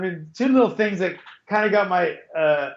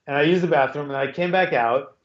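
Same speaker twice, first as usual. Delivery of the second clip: ordinary talk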